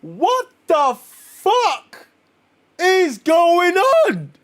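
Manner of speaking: high, slow, stretched shout of disbelief; shocked outburst. Real, not acted. Reacting to Thanos crashing the wedding, high shocked shout.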